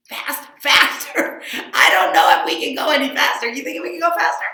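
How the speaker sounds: loud, high, laughing challenge; worked-up shout between song rounds; excited disbelief. Real, not acted. Mock doubt at racing even faster.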